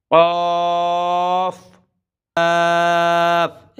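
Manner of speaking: কফ then কাফ, both held long; two takes drawn long. Shown as a demonstration. Teacher contrasting কফ and কাফ. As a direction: stretch কফ and কাফ slowly to set them apart. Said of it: "একটা হবে কফ".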